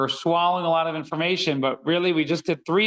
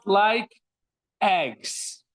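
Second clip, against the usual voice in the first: usual speaking voice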